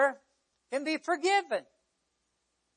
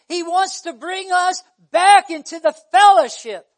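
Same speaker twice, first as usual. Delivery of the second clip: loud, raised-pitch pulpit preaching; 6 semitones higher, 13 dB louder than usual. Real, not acted. Preacher telling congregation of the crucifixion, preaching at full force.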